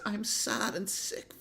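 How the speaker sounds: high, soft, slow pitiful whine; mock his sad sick voice. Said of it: "This is his low B M I voice".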